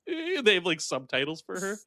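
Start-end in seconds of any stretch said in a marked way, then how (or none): 0.1-0.4 high, soft creature noises, imitated; weird voice. Monster needs subtitles for her speech, weird voice. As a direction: mimic a monster who can barely speak. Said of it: "weird voice".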